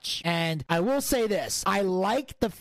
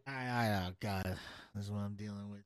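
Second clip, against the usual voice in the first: very low, soft mutter to himself; exasperated aside; 12 semitones lower, 12 dB quieter, faster than usual. Acted. The Don despairs of his co-host, muttered exasperation. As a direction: grumble under your breath.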